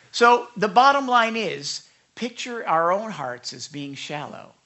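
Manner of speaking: ordinary talk, nothing marked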